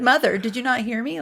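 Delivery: loud, high mock protest; mock indignant protest. Retelling her defence to the judgmental seatmate, mock indignant. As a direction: protest your innocence loudly, in jest.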